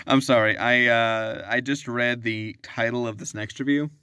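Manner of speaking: ordinary talk, nothing marked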